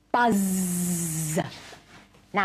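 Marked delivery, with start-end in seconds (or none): 0.1-1.4 "Buzz" with a long held z; stretched word sounds. Shown as a demonstration. Teacher showing sounds in words, "Buzz" with drawn-out z. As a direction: hold the z in "Buzz". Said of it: "See if you can hear the sounds".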